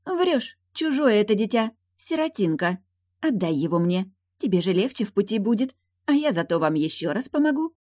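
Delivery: high start, wheedling mermaid voice; coaxing mermaid. Acted. Mermaid coaxes for the baby.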